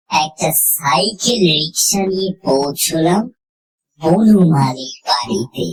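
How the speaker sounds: ordinary delivery, nothing marked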